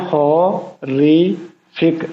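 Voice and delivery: Horrific very slowly as हॉ, रि, फिक; syllable by syllable. Shown as a demonstration. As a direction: stretch horrific into three separate syllables, pausing between. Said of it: "इसके कितने syllable हैं".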